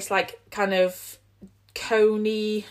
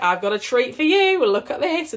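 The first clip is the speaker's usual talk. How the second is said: lightly hammed-up reveal, higher and lifted; playful mock presenting; 5 semitones higher, 5 dB louder than usual. Pregnant podcaster showing her partner the bandage, playful mock presenting. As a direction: show it off like a mock treat.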